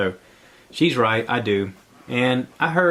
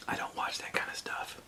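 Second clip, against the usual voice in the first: breathy whisper, a private confession; whispered aside; 15 dB quieter than usual. Real, not acted. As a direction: drop to a whisper for a quick aside. Hushed aside to listeners.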